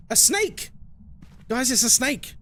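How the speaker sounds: high, gasping cry of a snake; hammed-up panic about a snake; a pretend snake scare; mock alarm.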